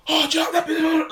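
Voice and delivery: very high, loud mock whisper; failed whisper. Drunk cinemagoer talking to her mate, loud mock whisper. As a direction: imitate someone whispering far too loudly. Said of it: "they were trying to whisper".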